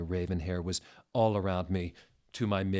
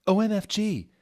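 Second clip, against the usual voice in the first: excited squeal; 13 semitones higher, 8 dB louder than usual. Acted. Another excited outburst.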